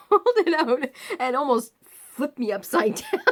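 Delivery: telling it through laughter; high, breaking into laughs on the words; laughing. Real, not acted. Recalling dad nearly flipping the chair, laughing.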